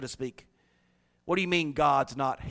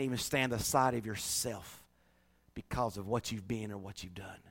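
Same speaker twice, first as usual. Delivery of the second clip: tender hush; 3 semitones lower than usual. Acted. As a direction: bring it right down to comfort them. Preacher drops to tender hush.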